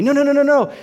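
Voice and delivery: normal talking voice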